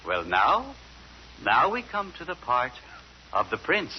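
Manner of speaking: usual speaking voice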